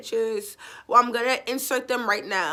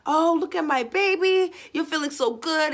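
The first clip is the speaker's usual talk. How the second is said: put-on baby talk; 6 semitones higher than usual. Owner greets her recovering dog in high baby talk. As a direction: gush over the pet, pitched way up. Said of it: "and I'm like".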